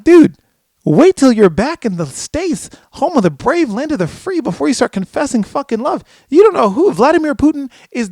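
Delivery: high, loud mock-panicked scolding; exasperated warning. Warning a superstar his phone is tapped, exasperated. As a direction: plead with them loudly, hammed-up exasperation.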